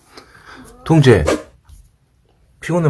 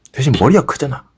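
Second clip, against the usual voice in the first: ordinary delivery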